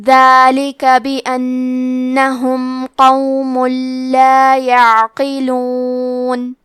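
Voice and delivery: slow, measured tajweed chant; Quran recitation. Acted. Teacher reciting the verses for students, slow chant. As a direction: recite each phrase slowly and melodically.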